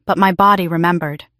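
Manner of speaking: ordinary delivery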